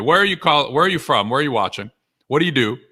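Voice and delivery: normal talking voice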